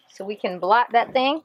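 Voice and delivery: normal talking voice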